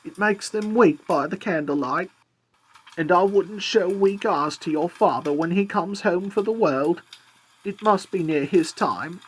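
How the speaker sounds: mother's voice. A narrator's reading. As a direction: voice the tired mother, lifted high. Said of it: "said Cratchit's wife".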